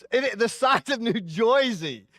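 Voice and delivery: New Jersey accent, Jersey said like "Joysey", slow and high. Shown as a demonstration. Pastor mocks the New Jersey accent for the congregation, slow and high.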